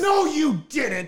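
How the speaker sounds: high, loud, drawn-out mock disbelief; shocked mom. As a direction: imitate an appalled mother, stretching it out. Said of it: "And my mom's like".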